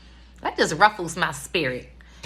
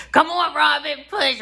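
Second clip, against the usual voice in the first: mock boot camp instructor; 8 semitones higher, 3 dB louder than usual. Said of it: "looking like he the, uh, boot camp instructor".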